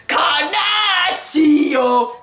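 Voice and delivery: loud, high, long drawn-out yell; mock attack scream. Girl suddenly stabbing him, mock battle scream.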